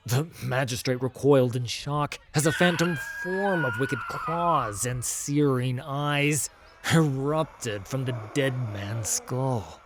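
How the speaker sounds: ordinary delivery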